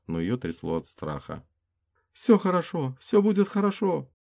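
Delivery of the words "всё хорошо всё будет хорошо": much higher, louder panicked reassurance; high frightened female voice; man down shout. Acted. Frightened woman reassures wounded.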